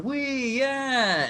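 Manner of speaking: high gleeful squeal; thrill ride whee. Shown as a demonstration. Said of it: "in an amusement park going".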